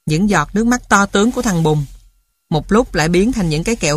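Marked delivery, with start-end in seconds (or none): none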